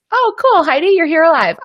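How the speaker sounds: high-pitched, delighted greeting; light up on spotting a familiar name; excited surprise. Real, not acted. Host spotting Heidi joining the live, excited and surprised.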